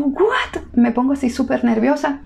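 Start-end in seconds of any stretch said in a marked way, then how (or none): 0.0-0.6 a sudden, much higher startled "What?"; shocked what. Retold or imitated. Retelling the midwife's alarm, she gives a high startled "What?". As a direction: jump up in pitch, caught off guard.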